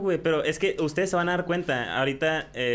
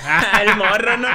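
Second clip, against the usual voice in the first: laughing; 8 semitones higher, 7 dB louder, slower than usual. Real, not acted. Laughing at old self.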